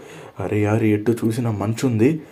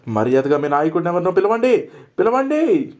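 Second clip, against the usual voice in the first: demanding shout; 8 semitones higher than usual. Acted. Captive ordering the guards to fetch their leader, angry shout. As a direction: demand it, then yell it.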